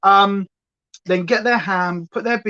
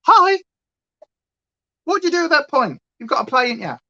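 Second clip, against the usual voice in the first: eager dog voice; 8 semitones higher, 4 dB louder, faster than usual. Pretend. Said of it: "And he's like".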